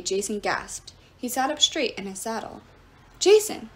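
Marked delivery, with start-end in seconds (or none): 3.2-3.7 much higher, louder cry; alarmed cry. A narrator's reading. Piper cries to Jason in alarm, loud. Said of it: "Piper cried".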